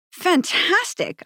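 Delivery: high, slow, drawn-out "Fantastic"; mock-impressed "Fantastic" to the gang boys, high and slow; dry fantastic. Dry approval of gang tag.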